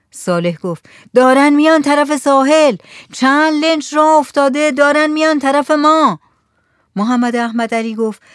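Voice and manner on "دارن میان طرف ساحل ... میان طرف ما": high, loud lookout's report; shouted warning. A narrator's reading. Rooftop lookout warning villagers of launches coming, shouted. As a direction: shout the alarm across a distance.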